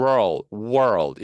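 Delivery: "world" with R at the back gliding to front L; R pulled to L. Shown as a demonstration. Teacher repeating the hard word, R-to-L glide in "world". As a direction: pull through the R and L of "world", low. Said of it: "뒤에 있다가 앞쪽으로 당겨져야".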